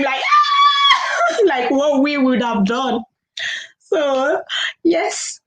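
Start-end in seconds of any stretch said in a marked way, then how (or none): 0.2-1.4 a high laughing mock scream "Ah!". Host showing how the friend should have screamed, high laughing shriek. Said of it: "like, scream".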